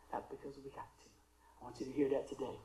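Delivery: normal talking voice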